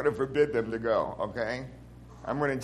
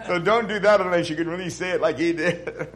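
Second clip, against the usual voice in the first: laughing; 3 semitones higher, 5 dB louder than usual. Real, not acted. Still laughing after story.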